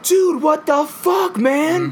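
slow, high, drawn-out outraged "dude"; high slow mock outrage; shocked dude. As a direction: stretch it out in disbelief at the spoiler. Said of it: "And I'd be like".

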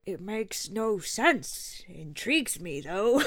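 slow, drawn-out film detective impression; Daniel Craig. Co-host quoting Knives Out when asked about the sequel, slow detective impression. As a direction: put on a ponderous detective's drawl. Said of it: "Is that your Daniel Craig?".

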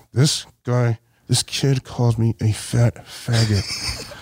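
low, slow and deliberate; weighty low-voiced complaint; grave accusation. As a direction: spell out the accusation word by word. Face to face, reporting the insult.

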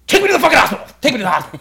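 loud, high desperate yell; acted frantic demand; panicked shout. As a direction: scream it like you need saving right now. Retells demanding the hospital mid-panic.

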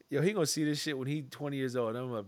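usual speaking voice

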